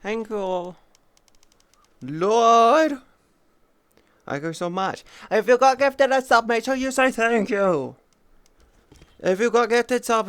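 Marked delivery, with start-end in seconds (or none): 2.0-3.0 louder, higher cry of Lord; loud exclamation. Real, not acted. Exclaiming at gift. 5.3-10.3 higher, louder scolding reminder; telling gifted subs to say thanks; loud, high lecture. Real, not acted.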